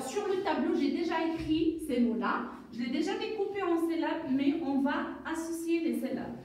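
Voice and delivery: normal talking voice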